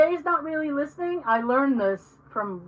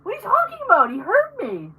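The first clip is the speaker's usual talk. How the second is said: incredulous; 9 semitones higher, 4 dB louder than usual. Retold or imitated. Arguing with her mother, incredulous high protest. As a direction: pitch jumps high on the first question, then settles. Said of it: "And I said".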